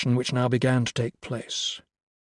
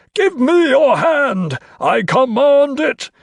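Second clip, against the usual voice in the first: slow, high, loud demand; crying out in command; 12 semitones higher, 8 dB louder, slower than usual. A narrator's reading. Carstairs demands Crow's hand, shrieking command. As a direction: shriek each word as an order.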